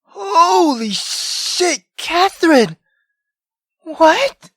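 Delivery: ordinary talk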